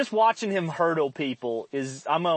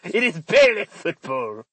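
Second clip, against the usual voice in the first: hammed-up Frenchman, louder; mock French accent; 2 semitones higher, 4 dB louder than usual. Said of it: "if you were a Frenchman".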